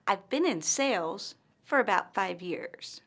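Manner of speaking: words run together, then a break after "sales" and after "years"; group the words, pausing after "sales" and "five years"; pause after SALES. Shown as a demonstration. Teacher shows Amanda's phrasing, pause after "sales" and "five years". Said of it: "Amanda pauses after the word sales and five years".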